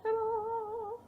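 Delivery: high bright reveal of the socks; showy ta-da. Real, not acted. Showing the knitted socks to viewers, high ta-da. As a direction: sing out the reveal like a magic trick.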